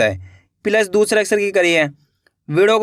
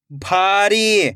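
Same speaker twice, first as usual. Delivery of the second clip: भारी joined as one slow word; blended word; much slower than usual. Shown as a demonstration. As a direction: read भारी whole, falling at the end. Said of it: "खींच के पढ़ना है".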